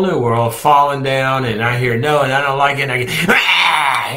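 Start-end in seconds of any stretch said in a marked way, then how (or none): 3.3-4.1 mock tantrum yell, high and loud; hammed-up toddler wail; toddler tantrum cry. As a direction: shout like a frustrated toddler. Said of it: "I'm throwing tantrums".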